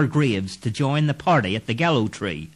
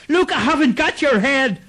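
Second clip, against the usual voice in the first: high, louder panicked cry; frightened scream; 4 dB louder than usual. Acted. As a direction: yell it in fright at the ghost. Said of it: "Clancy screamed".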